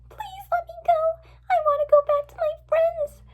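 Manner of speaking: put-on high, pleading girl voice; crying plea. A narrator's reading. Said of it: "Fluttershy cried".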